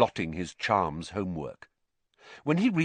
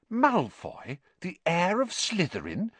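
high, doubting question; sceptical; 10 semitones higher than usual. Acted. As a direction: query it with open scepticism. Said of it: "said Hermione sceptically".

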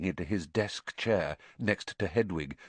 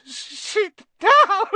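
very high, loud tearful elf wail; 9 dB louder than usual. A narrator's reading. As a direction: burst into a drawn-out squealing wail. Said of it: "he wailed".

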